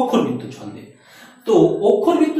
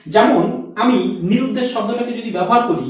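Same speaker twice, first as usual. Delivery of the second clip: ordinary talk, nothing marked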